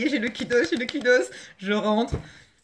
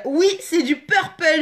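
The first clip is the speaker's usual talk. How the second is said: gleeful exclaim; 6 semitones higher, 6 dB louder than usual. Real, not acted. Delighted reveal of the product.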